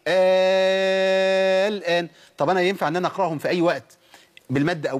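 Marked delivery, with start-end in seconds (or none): 0.1-2.1 آه held very long, then الآن; long steady opening hold, finishing briskly on الآن; six-count madd. Shown as a demonstration. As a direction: sustain one level aa before the l of الآن. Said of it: "أقول إيه؟".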